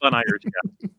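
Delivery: high, gushing fanboy voice; hammed-up adoring player; mock eager player. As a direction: squeal it like a smitten sidekick. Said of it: "you're just as a player like".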